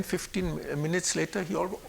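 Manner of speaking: ordinary talk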